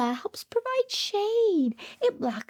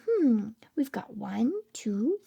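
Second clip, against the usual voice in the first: ordinary delivery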